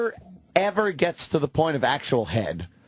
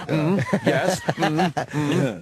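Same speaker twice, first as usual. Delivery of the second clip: thoughtful hmm, pitched up; pondering psychiatrist; 3 semitones higher, much slower than usual. Retold or imitated. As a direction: muse like a therapist. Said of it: "like a psychiatrist".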